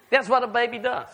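ordinary talk

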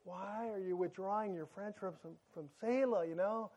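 father gently asking his son why he's being unkind; gentle fatherly. Retold or imitated. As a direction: crouch down and coax him softly like a dad. I get down on his level.

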